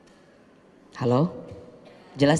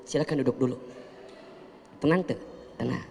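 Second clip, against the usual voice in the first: lower, softer, unhurried hosting; quietly reassuring wife; calm and gentle; 5 semitones lower, 6 dB quieter than usual. Retold or imitated. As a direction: soothe the debt collector calmly. Calm wife hosting debt collector.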